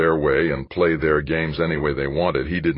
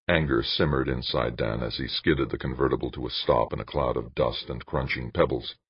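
usual speaking voice

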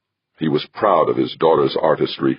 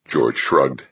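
ordinary delivery, nothing marked

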